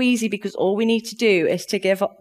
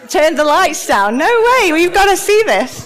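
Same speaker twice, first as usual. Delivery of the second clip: high, loud call to the room, laughing; excited shout; 9 semitones higher, 9 dB louder than usual. Real, not acted. Speaker urging the audience to dance, excited loud call. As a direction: whip the crowd up, loud and high.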